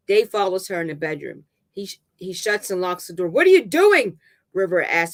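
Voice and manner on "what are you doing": high, loud panicked question; petrified. A narrator's reading. River as Dave locks the door, petrified cry. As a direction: cry out in fright.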